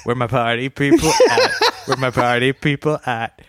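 imitating a tinny auto-tuned robot chant; hammed-up party robot call; horrible robot voice. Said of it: "this is his horrible robot voice".

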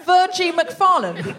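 delighted slow repeat of Virgie McFarland; savouring the name. Shown as a demonstration. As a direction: relish the silly name, laughing. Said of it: "Have you made that up?".

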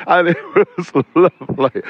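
giggling over the words; laughing through words. Real, not acted. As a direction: chuckle through it, delighted. Laughing while telling prank.

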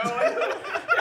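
loud high burst of laughter. Real, not acted. Friends hearing the PlayStation story, laughing hard. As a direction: crack up.

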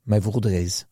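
low-pitched comic French raconteur; mock French accent. Joking about a Marseille condom dispensary, mock French accent. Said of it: "I'm sounding more and more French".

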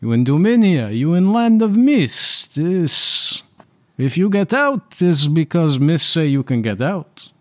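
a high, hesitant ship captain dodging the question; nervous captain voice. Acted. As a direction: stammer it out like a cornered sea captain. GM holds the captain's voice.

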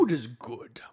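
lightly voiced game announcer; game narrator voice. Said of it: "the guy from Gauntlet Legends".

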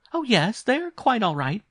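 high, loud, startled reply; startled governess. A narrator's reading. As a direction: answer high and loud, taken by surprise. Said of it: "terribly surprised and startled".

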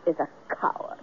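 soft hushed whisper; whispered confession. Acted. Woman whispers her secret to the hunter, hushed. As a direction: whisper so the camp can't hear.

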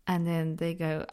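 ordinary talk

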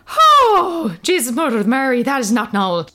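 high, loud Irish exclamation of shock; shocked Irish midwife. Retold or imitated. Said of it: "She went".

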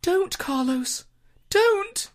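high, slow, wailing ghost voice; unearthly voice. Acted. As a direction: wail it like a ghost pleading. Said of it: "a ghostly voice whispering".

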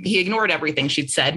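usual speaking voice

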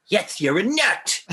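drawn-out camp robot line. Retold or imitated. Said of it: "And he says".

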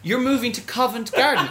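high, loud incredulous question; mock disbelief. Producer doubting his Covent Garden claim, high and loud disbelief. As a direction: act amazed at the answer.

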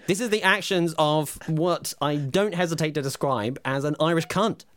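loud, high mock rage; hammed-up fury. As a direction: rant at him in a theatrical fury. Skit angry co-host.

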